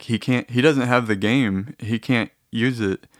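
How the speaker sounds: ordinary talk, nothing marked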